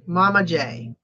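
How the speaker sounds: normal talking voice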